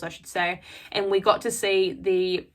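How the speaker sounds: ordinary delivery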